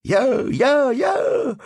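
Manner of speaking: high, loud eager shouts; thin little voices shouting. Acted. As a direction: shout like kids volunteering. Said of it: "закричало несколько тоненьких голосков".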